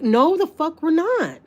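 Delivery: indignant, drawn-out no; retold anger. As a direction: refuse point-blank, outraged, stretching it out. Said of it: "I was so mad".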